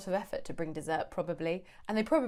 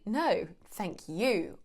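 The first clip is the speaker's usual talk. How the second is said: slow "No, thank you," leaning hard on "No"; stress on no; much slower than usual. Shown as a demonstration. Teacher demonstrating, slow stress on "No". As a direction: draw out "No" to turn the thanks back. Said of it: "This one is all about the emphasis".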